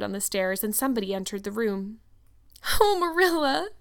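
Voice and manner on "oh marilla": high tearful wail; sobbing. A narrator's reading. Anne cries to Marilla from the bed, sobbing. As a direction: wail her name as if sobbing. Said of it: "sobbed Anne without looking up".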